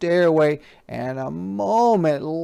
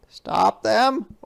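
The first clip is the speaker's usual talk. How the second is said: high, loud chuckling king; 9 semitones higher, 6 dB louder than usual. Acted. King laughs off the page's warning, chuckling. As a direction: laugh it off, gloating. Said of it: "chuckled the king".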